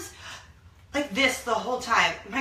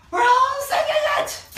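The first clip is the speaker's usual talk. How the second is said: excited squeal; 9 dB louder than usual. Real, not acted. Family can't stop singing.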